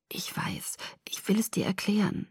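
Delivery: hushed, breath-only reply; whispering. Acted. Boy wants to explain himself to confused friend, whispering. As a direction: whisper it softly, wanting to explain.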